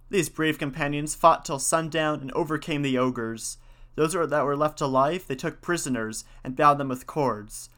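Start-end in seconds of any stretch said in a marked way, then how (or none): none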